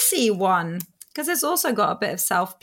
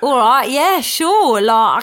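put-on loud, lifted Cockney boy voice; London Cockney accent; 5 dB louder, slower than usual. Shown as a demonstration. Showing an interviewer a bit of London, Cockney accent. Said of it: "London, Cockney boy".

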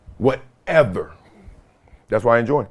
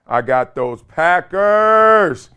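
slow gloat; much slower than usual. Real, not acted. Drawn out over slow delivery.

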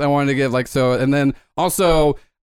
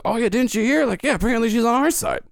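second guard voice; 8 semitones higher, faster than usual. Retold or imitated. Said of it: "you hear another voice go".

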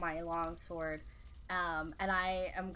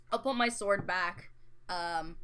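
normal talking voice

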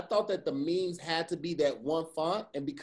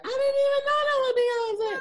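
much higher, louder whiny shock; teasing fake astonishment; mock amazement; 6 dB louder than usual. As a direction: mimic friends stunned by the fact. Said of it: "y'all gonna be like".

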